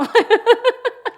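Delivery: high, loud laughter; long high laugh. Real, not acted. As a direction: keep laughing helplessly. Laughing after the quote.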